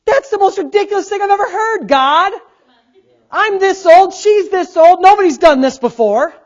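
scoffing Abraham. Acted. Abraham laughing at God's promise, high and loud scoffing. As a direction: scoff at God like it's a joke. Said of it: "What was he thinking?".

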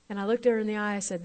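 usual speaking voice

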